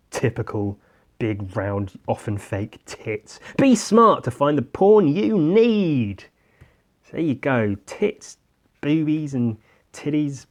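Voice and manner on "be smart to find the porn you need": slow, higher mock advert voice; announcer voice. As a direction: read it like a cheesy PSA. Mock public service announcement.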